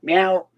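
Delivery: a short playful meow that rises at the end; mock meow. Owner voicing his cat being sued, with a playful rising meow. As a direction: answer for the cat with a cute little meow.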